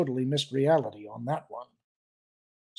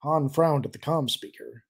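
plain reading voice

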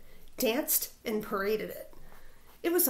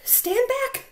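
high, loud booming command; 12 semitones higher, 6 dB louder than usual. Acted. Bully dog booming at the prairie dogs. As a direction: boom it out to clear the crowd. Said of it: "boomed a voice".

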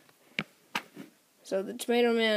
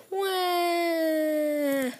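long high wail, slowly falling; cartoon bawling held on one breath; mock wail; 7 semitones higher than usual. Tomato Man running away defeated, mock wail. Villain runs away wailing.

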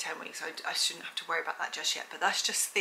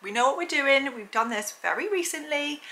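body voiced; 7 semitones higher, 4 dB louder than usual. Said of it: "My body's like".

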